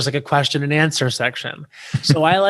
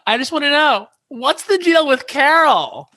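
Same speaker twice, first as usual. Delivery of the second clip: high gushing question; 12 semitones higher than usual. Retold or imitated. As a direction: squeal the question like a tipsy fan. Drunk fan's question at mic.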